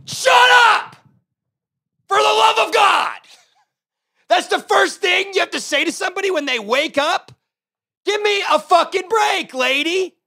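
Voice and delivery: high, loud yelling rant; exasperated shout. Retold or imitated. Ranting back at the woman who woke him, yelling high and loud. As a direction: blow up at her in disbelief.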